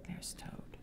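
put-on breathy hushed whisper to the kids. Reader whispers to children spotting Toad. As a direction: whisper as if sharing a secret.

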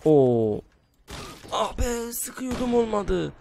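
frustrated cry. Real, not acted. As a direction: wail at the missed shot. Missed shot frustration.

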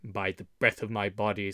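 low-voiced, marvelling oath; amazed Little John. A narrator's reading. Little John's amazed exclamation, low voice.

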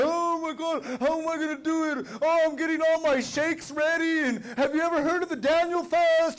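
high-pitched imitation of fretting churchgoers; mock complaining. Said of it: "everybody complaining and murmuring".

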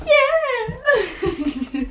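high laughing yeah. Real, not acted. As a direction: giggle through the word. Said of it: "I was so hyper".

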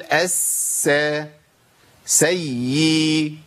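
الس then سي, each doubled sound drawn out slowly; doublings taken apart. Shown as a demonstration. Teacher counting four letters, slow pieces الس and سي. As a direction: break it up, holding the s, then the y of سي. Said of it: "أربع حروف".

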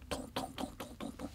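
soft breathy "トントン" for something dropped upstairs; whispered thumping. Retold or imitated. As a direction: tap it out under the breath. 二階で何かを落とす音. (ささやき声の物音)

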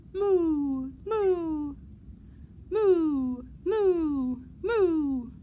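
loud, long cow moos; cow mooing. Acted. Librarian giving the cow's side of the contest, mooing. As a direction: moo like a cow, each one sliding down. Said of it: "The cow says".

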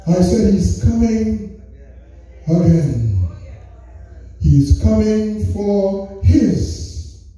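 slow drawn-out preaching. Acted.

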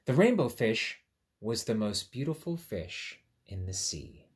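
put-on reading to children. A narrator's reading. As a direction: clear storybook read-aloud. Reads story aloud.

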